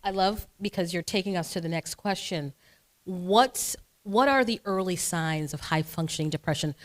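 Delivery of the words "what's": ordinary delivery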